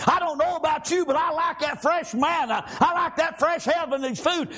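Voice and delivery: usual speaking voice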